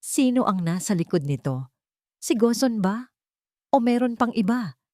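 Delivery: ordinary delivery, nothing marked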